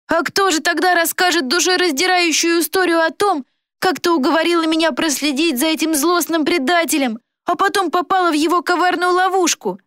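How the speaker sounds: high-pitched fake victim's tale; mock pitiful. A narrator's reading. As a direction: play the wronged boy, theatrically. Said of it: "Он сделал жалостливый вид и даже всхлипнул".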